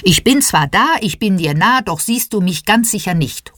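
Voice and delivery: ordinary delivery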